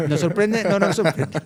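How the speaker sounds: laughing echo. Real, not acted. Laughing, echoing host's teasing remark.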